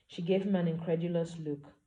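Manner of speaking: plain reading voice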